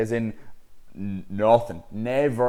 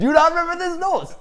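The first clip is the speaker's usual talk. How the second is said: 1 laughing squeal; 9 dB louder than usual. Retold or imitated. Joking with the hospital staff.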